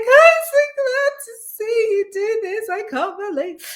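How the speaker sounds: high, loud, sobbing mock cry of joy; imitating crying sister. Sister on the phone from the car, imitated as tearful, high-pitched. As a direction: mimic the sister weeping happy tears down the phone. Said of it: "she starts crying".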